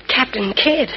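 ordinary talk, nothing marked

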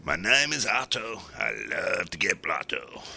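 slow, drawn-out mock American accent; slow attempt at an American voice. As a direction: put on an American accent, drawing the words out. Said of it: "been working on Amer-, on my American accent".

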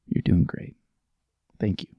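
hushed, breathy reassurance; whispered encouragement. Pretend. Comforting a struggling coworker in a whisper. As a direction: whisper it like a secret.

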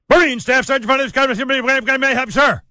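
brisk, high, loud military phone greeting; imitating a Marine on the phone. Host mimics a Marine recruiter answering the phone, clipped and loud. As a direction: rattle it off like a drill sergeant answering. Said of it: "It went something like this".

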